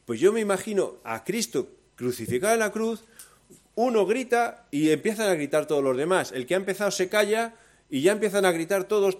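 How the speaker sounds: loud, high, fervent preaching; rousing preacher voice; preaching at full force. Acted. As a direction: build the sermon to a passionate peak.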